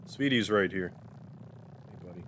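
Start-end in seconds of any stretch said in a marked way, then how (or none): 1.9-2.2 almost breathed, very soft; barely above a murmur to the cat; hushed pet greeting. Real, not acted. Greeting Speedy the cat, very soft and hushed.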